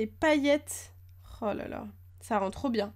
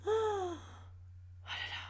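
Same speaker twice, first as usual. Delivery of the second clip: very slow, much softer, nearly breathed; hushed awe; 9 semitones higher, 10 dB quieter, much slower than usual. Real, not acted. Gazing at the shiny card, a hushed awed sigh. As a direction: let it melt away in quiet wonder. Admiring the secret rare card.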